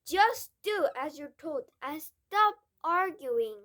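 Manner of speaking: loud, slow irritated order; snapping. A narrator's reading. Father scolding Matilda, loud snapping order. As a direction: shut the girl down. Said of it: "the father snapped".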